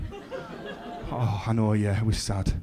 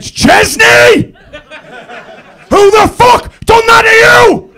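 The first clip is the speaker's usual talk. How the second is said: very high, loud shriek; screaming angry mother; 14 semitones higher, 10 dB louder, slower than usual. Retold or imitated. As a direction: shriek across the room like a furious mum. Angry mother bounding over, acted.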